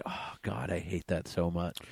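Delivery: low, soft imagined groan of dismay; quietly fed-up aside; muttered disgust. Retold or imitated. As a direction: grumble it under your breath. Imagined New Yorker reacting to stereotype.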